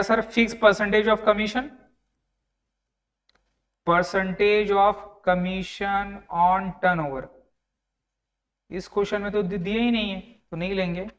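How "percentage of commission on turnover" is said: very slow, drawn-out dictation, word by word. Real, not acted. Teacher dictates the commission term to the class, slow dictation. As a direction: stretch each word out for note-takers.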